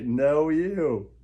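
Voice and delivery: ordinary talk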